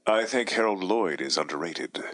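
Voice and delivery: deep Tatum voice. Shown as a demonstration. Co-host answers the line in deep Tatum voice. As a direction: drop low into a Tatum impression. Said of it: "in our deep Tatum voice".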